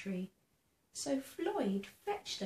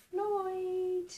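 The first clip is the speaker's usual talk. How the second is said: high, louder call across the street; 8 semitones higher, 3 dB louder than usual. Acted. A neighbour at the window calling out for Floyd. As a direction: call his name from a window. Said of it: "someone's calling out from the window".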